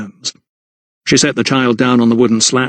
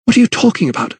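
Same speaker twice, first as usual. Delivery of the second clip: high, tense retort; exasperated high demand; snapped question; 10 semitones higher, faster than usual. A narrator's reading. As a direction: snap back, pitch raised. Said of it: "snapped Lydia".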